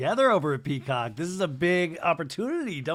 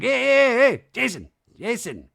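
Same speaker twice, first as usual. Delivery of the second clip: high, loud calling after someone; imitated calling out; 8 semitones higher, 7 dB louder than usual. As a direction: imitate him running up and shouting for Jason. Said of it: "He's like".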